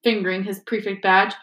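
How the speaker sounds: ordinary delivery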